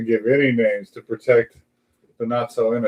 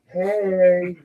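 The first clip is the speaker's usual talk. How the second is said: a sly, lightly high ask; mock favor ask; 10 semitones higher, 5 dB louder than usual. Driver teasing the judge in court, sly and high. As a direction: call in the old favor with a smirk. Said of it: "thought about going up to him and go".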